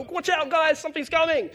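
high, loud call from the tower; shouted warning. Retold or imitated. Watchman on the tower warning the soldiers below, shouting. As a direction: yell down a warning to the troops.

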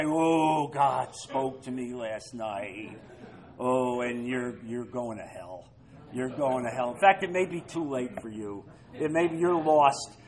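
mock self-righteous condemnation. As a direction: play the pious know-it-all condemning a friend. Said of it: "You speak like that".

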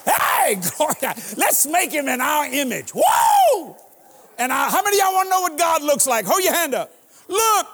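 high, loud and fast; preaching shout. Real, not acted. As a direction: whip the crowd up. Preacher at full climax.

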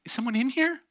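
high, nervous little question; mock frightened sister. Sleeping sister hearing a voice under her bed, mock frightened. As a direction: ask it high, as if spooked.

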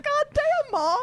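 hammed-up groan at mom; exasperated retort. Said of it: "I was like".

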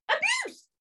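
very high-pitched squeal of disbelief; incredulous outburst. Retold or imitated. Passenger echoing the worker's accusation of abuse, incredulous. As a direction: throw the word back in a squeaky outraged pitch.

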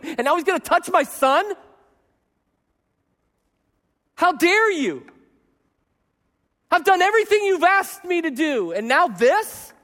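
how dare you, hurt and affronted; indignant outburst at God. Retold or imitated. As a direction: demand it of God, wounded. Pastor voicing his anger at God.

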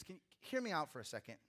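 ordinary delivery

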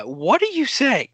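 drawn-out exasperated question, rising high. Real, not acted. Host pressing his mumbling friend, exasperated. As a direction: demand it slowly, exasperated.

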